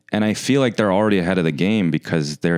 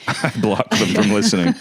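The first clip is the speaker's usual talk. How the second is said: words said through a laugh; 3 semitones higher than usual. Real, not acted. Host joking about blocking listeners, laughing. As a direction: play along while chuckling.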